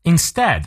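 INSTEAD with the stress on STEAD; second-syllable stress. Shown as a demonstration. Teacher modelling Instead, stress on second syllable. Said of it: "重音在第二个音节".

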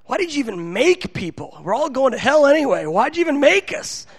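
high-pitched, acted protest to God; exasperated complaint. Fed-up youth minister asks God why, exasperated complaint. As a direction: plead upward in mock despair.

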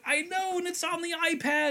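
very high, hammed-up nerdy excitement; mock gushing reply. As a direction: squeal it like a thrilled fan. Acting out own gushing reply.

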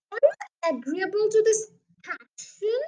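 lightly voiced formal, high-pitched inquiry; officious police sergeant. Sergeant seeks the father's agreement, lightly pompous. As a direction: ask it with official pomp.

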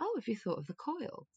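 a hint of a soft, dainty English Rose; softly spoken friend. Rosie gently suggesting the coil, lightly softened voice. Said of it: "'cause she's just so softly spoken".

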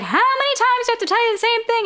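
high, rapid exasperated voice; mock yelling. [name] talking over blaring metal, fast high mock yell. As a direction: rattle it off high like calling over noise. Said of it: "talking very loudly".

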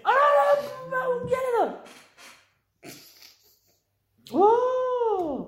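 high, loud and slow excited cry; excited shout. Real, not acted. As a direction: yell out in delight at the find. Spots a trainer on the map.

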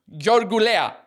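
Georgulea said as a Greek surname, high and loud; Greek-style pronunciation. Shown as a demonstration. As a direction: attempt Georgulea the Greek way, sounding out each syllable. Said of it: "how you would say it in Greek".

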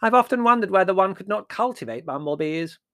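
high, loud musing; thoughtful dotty aunt. A narrator's reading. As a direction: ponder aloud in a piping voice. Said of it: "said Aunt Fan thoughtfully".

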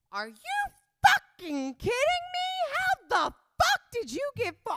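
high, very loud nasal screech; outraged nasal mom. Retold or imitated. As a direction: screech like a furious mother, through the nose. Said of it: "I always make her voice like that".

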